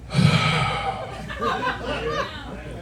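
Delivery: long, weary exaggerated sigh; deep sigh. Seven-year-old goddaughter sighs at her uncle, exaggerated weary sigh. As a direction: sigh like a bored child. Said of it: "had the nerve to deep sigh at me".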